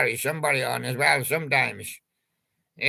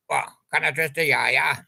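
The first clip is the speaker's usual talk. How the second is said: ordinary talk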